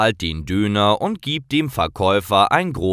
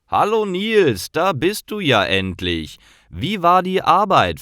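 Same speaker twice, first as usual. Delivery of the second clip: lifted, higher voice for Mustafa greeting his customer; bright raised greeting; friendly shopkeeper; 9 semitones higher than usual. A narrator's reading. Kebab shop owner greets Nils, voiced higher.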